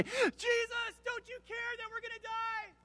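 very high, thin strained cry; acted panic and terror. Disciples turn to Jesus, crying in panic and terror. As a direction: squeal it out in mock terror, soft and high. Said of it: "in panic and in terror and say".

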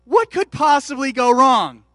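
loud, slow, ironic ask; ironic rhetorical question. Real, not acted. Preacher to congregation, loud slow ironic question. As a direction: spell out the looming disaster.

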